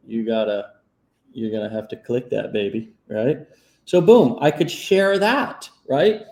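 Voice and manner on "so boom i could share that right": excited. Real, not acted. Excited about the post.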